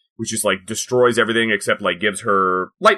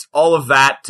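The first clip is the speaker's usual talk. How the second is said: ordinary talk, nothing marked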